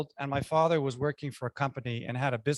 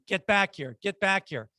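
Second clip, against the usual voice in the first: mother's yell, higher and half-raised; retold yelling; 5 semitones higher, 3 dB louder than usual. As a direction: mimic an angry call across the yard, held back.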